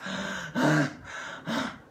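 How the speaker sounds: breathless gasp. Retold or imitated. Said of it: "he's out of breath".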